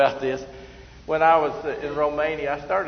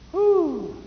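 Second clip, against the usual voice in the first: high-pitched "whew"; excited whoop; 13 semitones higher, 3 dB louder than usual. Real, not acted. Preacher moved by the mercy seat, high whoop. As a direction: let out a high, delighted whoop.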